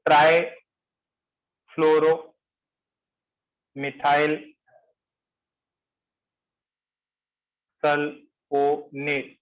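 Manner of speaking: ट्राईफ्लोरोमिथाइल very slow with long gaps inside, then sulfonate broken up; syllable-chunk dictation. Shown as a demonstration. Teacher introducing a long compound name to the class, very slow dictation. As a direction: stretch the long name out piece by piece.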